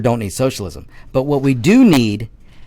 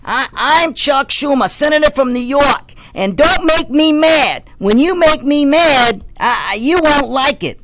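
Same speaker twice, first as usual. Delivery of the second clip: Chuck Schumer impression; 4 dB louder than usual. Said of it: "that may not be the best impersonation".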